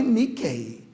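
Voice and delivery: Mickey ending in "ay"; wrong "ay" ending. Shown as a demonstration. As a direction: say Mickey as mick-AY. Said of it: "Não fale".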